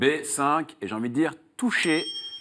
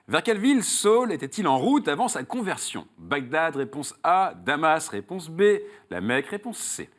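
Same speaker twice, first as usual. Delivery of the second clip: raised, emphatic game-show host voice, settling on the last option; quiz question read; 5 semitones higher than usual. Acted. Host quizzes a contestant on Saul's road, quiz question read. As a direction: pose the question and lay out each choice.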